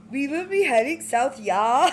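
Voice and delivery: hammed-up Swedish "heading south"; Swedish accent as Inga. Announcing the ride route in a mock Swedish accent. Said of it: "I have to do the bang things".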